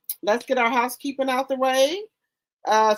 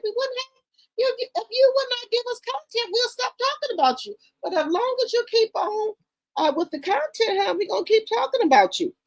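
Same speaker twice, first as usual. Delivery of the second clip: mocking taunt; 12 semitones higher than usual. Taunting Clarissa directly.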